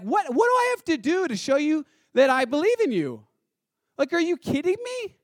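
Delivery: high, loud, incredulous outburst; exasperated protest. Acted. Preacher as Abraham objecting to God's command, exasperated. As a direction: protest point by point, voice leaping up.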